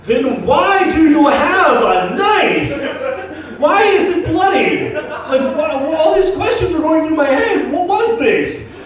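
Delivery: incredulous shouting. Retold or imitated. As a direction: demand answers in loud disbelief. Confronting the knife guy.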